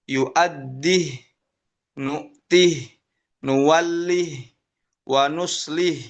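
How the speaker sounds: Yu'addih, nu'tih cut off on a silent "h", no vowel after it; recited with sukun. Shown as a demonstration. Teacher shows students Syu'bah's reading, each word ending on sukun. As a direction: clip each final "h" short and still. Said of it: "dibaca dengan sukun haknya".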